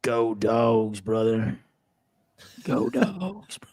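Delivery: mock Georgia drawl on Go Dogs brother; hammed-up Southern Georgia fan cheer; playful Georgia accent, half laughing. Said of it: "Southern Georgia accent out".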